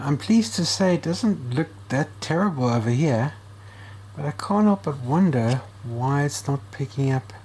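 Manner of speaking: ordinary talk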